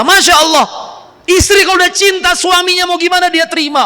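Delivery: rousing sermon cry; preaching shout. Real, not acted. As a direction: proclaim it at full force. Preacher exclaims to congregation.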